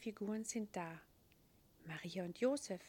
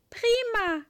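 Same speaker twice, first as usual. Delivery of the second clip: high, loud happy call; boy thrilled the angel will help; delighted shout; 14 semitones higher, 15 dB louder than usual. Acted. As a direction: cheer it out. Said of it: "ruft Moritz".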